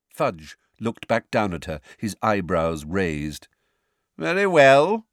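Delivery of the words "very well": loud, high-pitched aloof concession. A narrator's reading. Minister lets the witness go on, aloof. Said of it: "he said aloofly".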